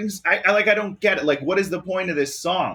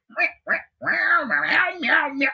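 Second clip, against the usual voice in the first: high, slow fake meows; mocking cartoon cat meows; mock meowing; 10 semitones higher, much slower than usual. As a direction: drag out each meow like a movie cat. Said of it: "fake meowing in this movie".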